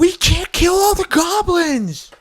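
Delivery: very high, drawn-out desperate scream; hammed-up screaming protest; Grinch. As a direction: shriek the plea at full stretch. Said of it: "the Grinch arrives".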